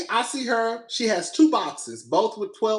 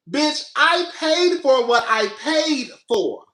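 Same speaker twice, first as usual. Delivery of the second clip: indignant; 4 semitones higher, slower than usual. Real, not acted. Indignant rant at the donut clerk.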